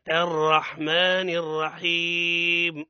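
"الرحمن" with a clear hamza, slow and drawn out; correct recitation. Shown as a demonstration. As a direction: recite "الرحمن الرحيم" slowly with the hamza fully sounded. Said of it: "ايش نقوله؟". (ترتيل بطيء مع تحقيق همزة "الرحمن")

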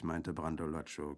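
ordinary delivery, nothing marked

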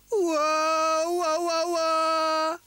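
loud, high, sustained jungle cry; long swinging-on-a-vine holler; Tarzan yell. A narrator's reading. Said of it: "schrie Karlchen und fühlte sich wie Tarzan".

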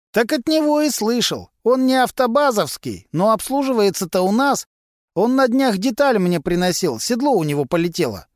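a sly garage lowlife gossiping to cops; Vasily, a gruff mechanic. A narrator's reading.

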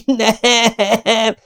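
long, high howl of protest; drawn-out high-pitched wail; howled cry. A narrator's reading. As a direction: cry out in horror, stretching the word. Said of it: "üvöltött föl Luke".

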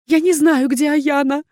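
high, loud, trembling denial; hurried frightened plea. A narrator's reading. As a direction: protest in fear, high-pitched. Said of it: "торопливо зашептала Виверна".

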